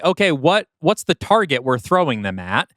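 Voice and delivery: ordinary delivery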